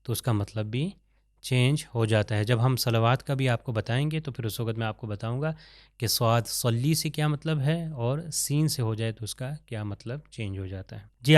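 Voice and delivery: ordinary talk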